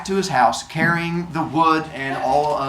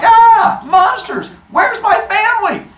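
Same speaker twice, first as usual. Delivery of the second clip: much higher, much louder panicked yell; panicked shout; 9 dB louder, slower than usual. Acted. Heracles attacked by monsters: high panicked shout. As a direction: scream it as the monsters rush you.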